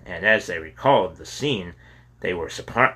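ordinary delivery, nothing marked

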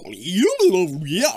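high, loud garbled babble; garbled tape. Retold or imitated. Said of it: "it's like".

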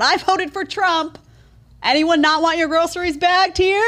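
high, slow, hammed-up announcement; mock blurting shopper. Host mocks a shopper, high mocking blurt. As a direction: parody someone blurting their vote.

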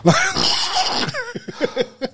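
high squealing laugh; high laugh burst. Real, not acted. Cohost bursts into high squealing laughter.

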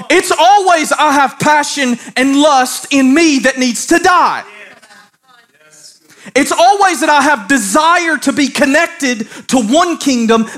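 high, impassioned sermon delivery; full-force preaching. Real, not acted. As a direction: preach it hard, driving each phrase home.

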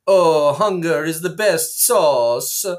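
put-on Italian restaurateur accent; Italian waiter accent. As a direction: savour each word like an Italian waiter. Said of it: "he says in his accent".

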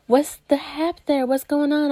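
ordinary talk

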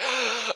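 quick high gasp for air; winded gasp. Retold or imitated. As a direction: gasp like you're out of breath. Winded after two football plays.

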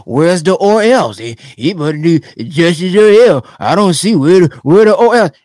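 hint of a whiny online critic; mocking online men. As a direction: parody the online guys. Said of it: "males online talking about".

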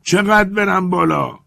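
high, loud, slow call down from far up; shouting from the treetop. Acted. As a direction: yell it down from the branches. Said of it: "از همانجا فریاد زد".

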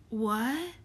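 mildly uneasy question with a rising end; a touch of unease. A narrator's reading. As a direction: ask it warily, voice lifting at the end. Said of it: "he said uneasily".

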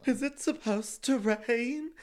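high, slow, exaggerated scared voice; imitated fearful question. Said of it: "That's what you sounded like".

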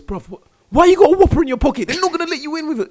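very high, loud bouncer. Pretend. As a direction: bust him at the door. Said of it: "He goes".

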